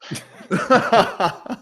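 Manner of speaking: loud high laugh; burst of laughter. Real, not acted. Host cracks up at the best man's joke, laughing loudly.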